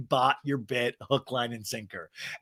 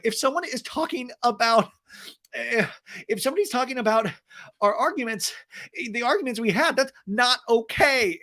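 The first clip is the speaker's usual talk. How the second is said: mock Shannon; 9 semitones higher than usual. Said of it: "Shannon's like".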